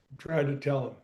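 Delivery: low, soft, muttered complaint; a retold grumble kept under the breath; hushed retort. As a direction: keep it quiet so only one hears. Retold angry remark, hushed.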